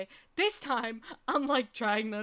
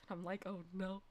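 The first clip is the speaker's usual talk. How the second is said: low, very soft aside; retold sinking feeling, said under the voice; small, hushed reaction; quiet dread; 4 semitones lower, 12 dB quieter than usual. Her dread as he mentions anime.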